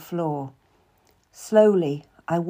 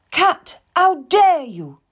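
high, loud and slow, biting down on dare; a queen turning on her servant in outrage; furious accusation; 9 semitones higher, 7 dB louder, slower than usual. Acted. Queen suddenly accuses the musician.

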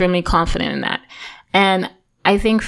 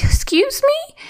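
very high, mock-offended question; imitated squeaky disbelief; retold affronted mom; slower than usual. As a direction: shoot the pitch way up, imitating an affronted mother.

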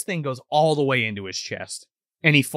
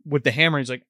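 ordinary talk, nothing marked

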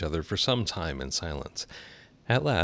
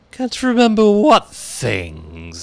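very high, loud, slow caterpillar; drawn-out caterpillar question; 10 dB louder, much slower than usual. A narrator's reading. Caterpillar voiced in story.

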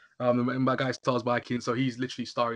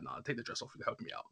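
whispered aside; 2 semitones lower, 15 dB quieter than usual. Retold or imitated. Husband voiced, unvoiced.

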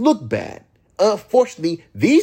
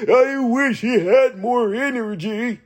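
mocking whiny critic; 6 semitones higher than usual. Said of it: "you're going to still say".

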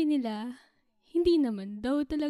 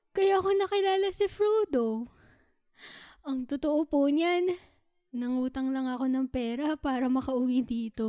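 ordinary talk, nothing marked

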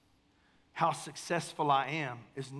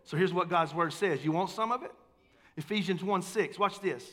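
normal talking voice

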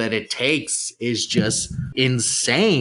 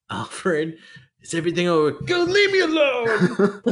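put-on sulky teen Batman; 8 semitones higher than usual. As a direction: whine it like a sulking teenager.

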